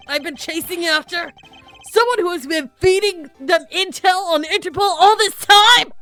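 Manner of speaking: loud, rising furious accusation; angry. Acted. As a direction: build to a furious shout. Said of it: "I got too angry".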